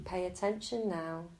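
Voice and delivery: ordinary delivery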